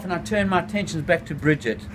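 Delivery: plain reading voice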